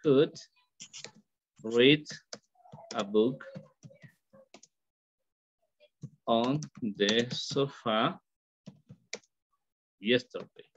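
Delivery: each word set apart by long pauses; slow, spaced teacher demo; slow model sentence. Shown as a demonstration. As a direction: dictate it word by word for the class. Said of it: "It is, for example".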